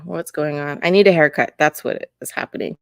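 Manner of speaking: ordinary talk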